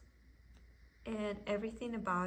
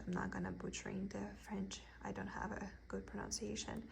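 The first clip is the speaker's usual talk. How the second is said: whispered aside; 5 dB quieter, faster than usual. Real, not acted. Whispered aside about French.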